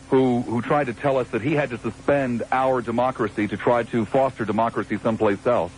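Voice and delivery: ordinary talk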